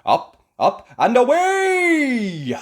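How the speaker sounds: loud, very high, drawn-out away; cheering cry. Acted. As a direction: launch the ride with a cheer. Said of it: "he cried".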